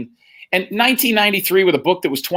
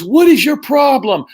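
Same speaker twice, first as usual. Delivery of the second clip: higher, louder, slow angry demand; furious teacher, raised and slow; 9 semitones higher, 6 dB louder, slower than usual. Retold or imitated. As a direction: snap at the class clown. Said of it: "And she goes".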